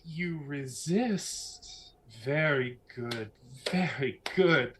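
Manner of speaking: slow, drawn-out approval; measured, mysterious praise; impressed villainess. Acted. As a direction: savour each phrase with sly admiration. Game master voices Lucretia.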